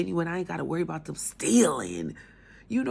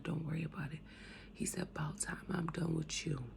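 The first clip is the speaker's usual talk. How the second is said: soft reassurance; 8 dB quieter than usual. Retold or imitated. God's comforting voice, retold.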